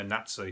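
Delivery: Nazi said NAT-see; short 'a' in Nazi, as in cat; Birmingham pronunciation. Shown as a demonstration. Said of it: "I'm from Birmingham".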